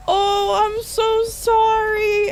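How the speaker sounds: high, loud, drawn-out wail of apology; hammed-up mortified apology, very slow; mock tearful apology. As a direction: squeal the apology like a child about to cry. Retells tearful apology to stranger.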